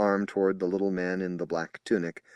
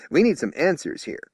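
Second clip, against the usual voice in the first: higher and louder insistent tone; strident demand; 5 semitones higher, 7 dB louder than usual. Acted. Spokesman demanding answers from the psychic, loud. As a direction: press him hard.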